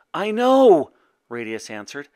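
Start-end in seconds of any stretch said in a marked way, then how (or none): 0.1-0.9 high, loud outburst; distressed answer. A narrator's reading. Boy answering his mother about the dragon, distressed. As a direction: burst out with it urgently.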